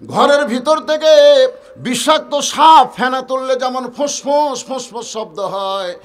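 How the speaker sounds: high preaching cry that settles into slow chant; dramatic sermon storytelling. Acted. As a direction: preach the scene loud, then draw out the ending. Melodic full-force preaching.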